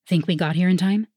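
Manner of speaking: plain reading voice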